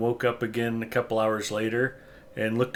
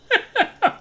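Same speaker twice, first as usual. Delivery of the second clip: high burst of laughter; 6 dB louder than usual. Real, not acted. Reacting to a joke.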